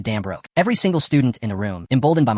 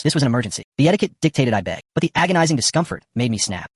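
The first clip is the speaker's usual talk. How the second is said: plain reading voice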